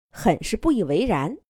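plain reading voice

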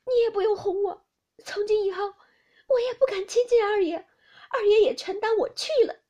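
high, loud tearful wail; loud, high, heartbroken crying protest. Acted. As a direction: break down sobbing as she pushes him away. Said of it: "因又撑不住哭道".

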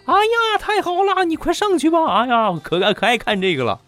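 high, gushing mock cheer of the classmates. Classmates cheering him up onto the stage, high mock squeal. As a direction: squeal it like teasing kids egging him on. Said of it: "鼓掌呐喊是".

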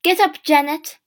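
loud, high-pitched wake-up order; shouting. A narrator's reading. Mother to Janet, shouting. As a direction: shout the child out of bed. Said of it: "my mother shouted".

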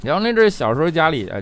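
usual speaking voice

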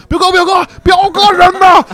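mimicking a scared teammate yelling for his cousin; mock frantic calling. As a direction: pitch it way up, searching and yelling. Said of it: "瑞小在那喊".

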